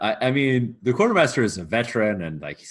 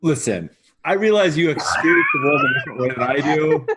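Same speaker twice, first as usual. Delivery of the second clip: loud, higher-pitched pleading argument; exasperated; 4 semitones higher, 5 dB louder than usual. Acted. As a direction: argue back like a fed-up mortal. Quartermaster argues back, exasperated.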